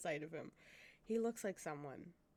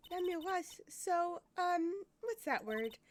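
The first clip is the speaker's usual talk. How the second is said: put-on high girl's voice, searching for a word; admiring girl character; 9 semitones higher, 6 dB louder, slower than usual. Girl fan describing the singer, admiring. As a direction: play a dreamy young fan.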